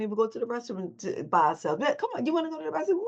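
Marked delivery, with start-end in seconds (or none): 1.8-3.0 high, fast chatty friend voice; imitating a friend. Speaker mimicking a friend inviting her to the restroom, high and quick. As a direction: pitch it up and rush it like a girlfriend. Imitating ladies going together.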